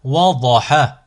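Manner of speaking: syllables joined as one word. Shown as a demonstration. Teacher models a word for learners to repeat, joined in one go. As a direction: run the syllables together without pauses. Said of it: "vous le prononcez d'un coup".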